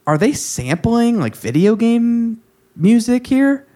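high-pitched, drawn-out puzzled question; mock-bewildered, rising high; incredulous question. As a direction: re-enact your baffled reaction, pitched well up. Retells own puzzled reaction to the music.

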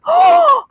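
high, sharp gasp; surprised gasp. Real, not acted. Reacting to the year-end listening summary.

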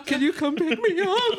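high, laughing through the quote; can't keep a straight face on the plea. Real, not acted. Friend retelling a funny voicemail, cracking up with laughter.

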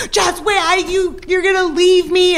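high, frantic imitation of a panicking man; freaking out. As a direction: squeak it out in panic, way up. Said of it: "He's like".

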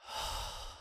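soft breathy release; long exhale. Shown as a demonstration. Coach demonstrating the out-breath, a soft sigh. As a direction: let the breath out slowly. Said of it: "deep breath".

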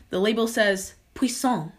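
ordinary talk, nothing marked

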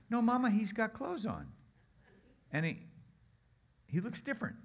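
put-on high, wondering little kid; child's voice. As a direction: tell mama in a small awed kid voice. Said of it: "one of his kids".

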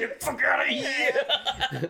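put-on slow, high, laughing Boston accent. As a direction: drag it out in a thick Boston accent. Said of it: "both of us with the Boston accent".

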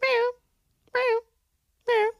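tiny high-pitched meow; short, high imitation meows; cat meow. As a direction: imitate a small cat mewing. Imitates the cat under car.

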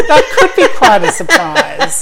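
giggling as you repeat the joke; laughing. Real, not acted. Repeating her friend's joke to her while laughing. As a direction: echo the line through laughter.

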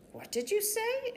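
a sudden startled question, pitched high. Acted. Aunt hearing the school news, startled and high.

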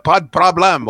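pas de problème in French. Shown as a demonstration. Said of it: "en français".

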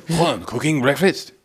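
put-on high-pitched comic French accent; hammed-up French accent; mock French accent. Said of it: "put on a French accent".

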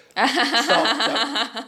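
high, slow, laughing stop; laughing mock protest. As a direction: beg them to stop through laughter. Reacting to the three hundred dollar price.

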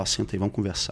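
low, soft retold instruction; eerily calm. Betrayed man sitting his partner down to talk, calm and quiet. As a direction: act out quiet self-control. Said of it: "Calmo assim?".

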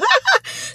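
a high squealing burst of laughter. Real, not acted. Laughing at herself to the audience, a high squeal of laughter. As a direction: crack up at your own story. Laughing at her own story.

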